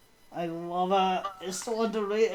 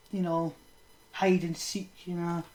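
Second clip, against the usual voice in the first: ordinary delivery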